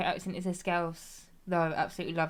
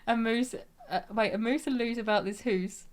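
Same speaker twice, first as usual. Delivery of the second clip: higher, put-on Scots accent; Scottish accent; 4 semitones higher than usual. Showing off party-piece Scottish phrases, broad Scottish accent. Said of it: "only thing I can say in a Glasgow".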